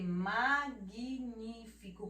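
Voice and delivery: magnífico slowed into "ma-gui-ní-fico"; "magnífico" syllable by syllable, i after the g; added vowel. Shown as a demonstration. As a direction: stretch magnífico with an audible "gui". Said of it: "como se tivesse o U e o I".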